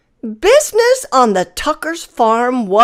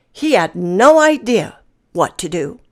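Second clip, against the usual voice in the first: ordinary delivery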